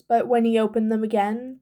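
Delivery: usual speaking voice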